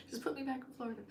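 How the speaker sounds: high, laughing and soft; laughing while wishing herself back in Florida; laughing plea. Real, not acted. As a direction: laugh it out, half-pleading.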